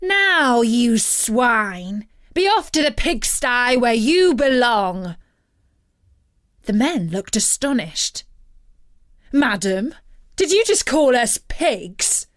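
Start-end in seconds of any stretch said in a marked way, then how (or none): 0.0-5.2 witch's command. A narrator's reading. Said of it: "she rapped the table with a magic wand and said". 9.3-12.2 louder, higher, indignant disbelief; outraged at the insult; astonished question. A narrator's reading. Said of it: "asked Eurylochus".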